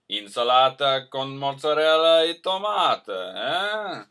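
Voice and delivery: joking Italian caricature ending in "eh?"; mock Italian. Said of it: "I'm being offensive to Italian people".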